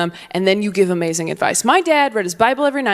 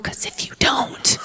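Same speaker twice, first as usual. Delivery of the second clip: menacing whisper; 5 dB quieter than usual. Acted. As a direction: whisper the threat, slow and soft. Repeats threat, then whispers it.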